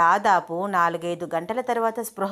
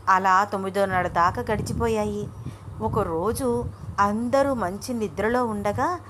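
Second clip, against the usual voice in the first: ordinary delivery, nothing marked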